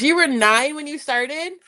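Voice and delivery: normal talking voice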